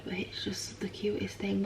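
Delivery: soft hushed whisper. Real, not acted. Baby asleep nearby.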